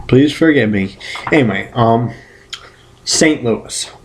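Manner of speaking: ordinary talk, nothing marked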